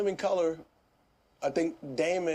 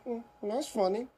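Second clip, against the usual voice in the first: unimpressed and dry; mock flat approval; deadpan; 6 semitones higher than usual. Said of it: "they go".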